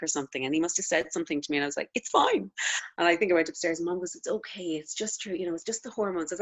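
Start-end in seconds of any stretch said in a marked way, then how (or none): 1.9-2.5 much higher, tight mock "it's fine"; curt denial. New mum brushes off her husband, high and curt. Snapping at Jim while weepy. 4.1-6.3 softer, gently reassuring mother; calm her down like a mother would; soothing mom. Retold or imitated. Mother comforts her weepy daughter, soft and soothing.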